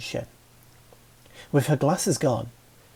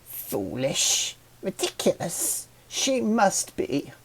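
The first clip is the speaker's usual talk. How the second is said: stubborn flower; 6 semitones higher, 3 dB quieter, slower than usual. Acted. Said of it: "the blue one stubbornly yelled".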